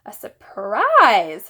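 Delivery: loud, slow wondering repeat; delighted child. A narrator's reading. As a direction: savor the word. Fudge delighted repeating word.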